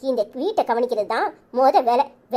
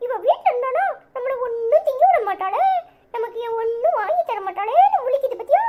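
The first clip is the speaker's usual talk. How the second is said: put-on much higher childish voice, cheeky and gleeful; 11 semitones higher than usual. Child gleefully telling mother how grandma panics, high kid voice. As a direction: play a little kid gloating over grandma.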